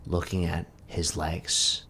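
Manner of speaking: ordinary delivery, nothing marked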